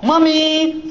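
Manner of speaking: high whiny call to mom; whiny kid tattling. Acted. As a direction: whine for mommy like a tattletale. Ducklings tattle again.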